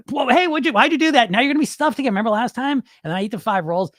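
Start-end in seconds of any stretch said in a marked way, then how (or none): 0.1-2.8 high-pitched inner voice telling him off; alarmed scolding. Acted. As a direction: act out an appalled self-reproach. Acted inner voice scolding himself.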